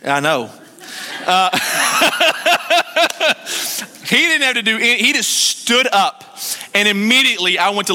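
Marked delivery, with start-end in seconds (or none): 1.5-4.0 high laugh. Real, not acted. Laugh at own childhood defiance.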